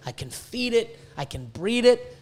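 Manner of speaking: usual speaking voice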